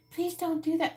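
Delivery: high, sweet pleading voice; meek mock plea. Mocking a parent asking kids sweetly, high meek plea. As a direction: ask like a pushover. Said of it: "But if you say".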